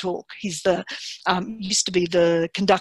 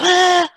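much higher, louder exasperated ah; exasperated cry; 10 semitones higher, 8 dB louder than usual. Retold or imitated. Partner at home bursting out in an exasperated ah. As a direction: burst out in irritation. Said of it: "the other person just went".

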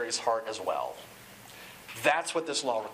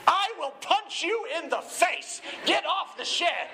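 much higher, slow, hammed-up screaming threat; mock scream; 11 semitones higher than usual. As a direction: yell murderous threats in comic rage. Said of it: "he's literally screaming".

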